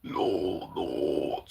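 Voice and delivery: low, drawn-out groaning notes; very low voice demo. Shown as a demonstration. Trying out very low growl voice.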